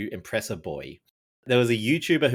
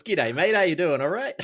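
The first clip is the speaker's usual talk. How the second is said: broad Aussie accent; 6 semitones higher than usual. Shown as a demonstration. Guest repeats the greeting that annoys his girlfriend. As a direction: ham up the Australian twang again, pitched up. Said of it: "grates her nerves".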